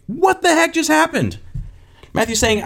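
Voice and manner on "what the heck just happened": very high, incredulous squeal; acted high-pitched disbelief; stunned amazement. Disciples reacting to the calmed storm, high-pitched bewilderment. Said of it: "Basically scared. The disciples were amazed".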